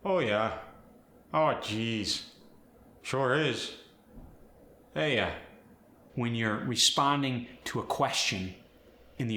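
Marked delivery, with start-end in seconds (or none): 0.0-5.3 stock Minnesotan phrases, one by one; embody a Fargo local; Fargo accent. Shown as a demonstration. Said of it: "embodying a character from this region".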